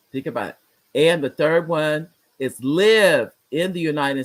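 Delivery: slow, measured listing with long pauses; drawn-out, emphatic recap; deliberate restating. Real, not acted. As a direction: spell out each requirement slowly. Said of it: "let me say it again".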